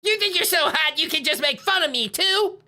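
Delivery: put-on petulant child villain complaint; whiny kid villain. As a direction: whine like a spoiled kid who lost. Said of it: "a really whiny voice".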